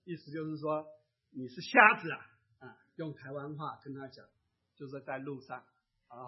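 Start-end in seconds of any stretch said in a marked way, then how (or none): 1.3-2.2 loud accusing retort, imitated; louder mock rebuke; loud scolding. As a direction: scold loudly, as an affronted passerby. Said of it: "却大声对他讲".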